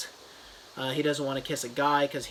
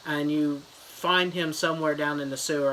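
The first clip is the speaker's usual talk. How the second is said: ordinary talk, nothing marked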